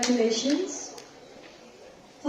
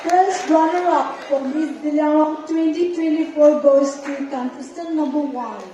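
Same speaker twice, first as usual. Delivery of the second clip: pageant announcer; 6 semitones higher, 5 dB louder than usual. Acted. Announces first runner-up.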